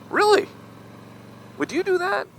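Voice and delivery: high, amazed rising question; incredulous. Retold or imitated. Answering his wife about the dirt road, high incredulous disbelief. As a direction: sound floored by the very idea. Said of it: "I'm like".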